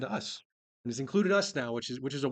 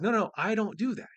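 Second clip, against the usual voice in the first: higher-pitched imitation of earnest denial; lightly acted, insistent objection; mock protest; 7 semitones higher, 4 dB louder than usual. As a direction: protest innocence on someone's behalf. Acting out Zacchaeus protesting his innocence.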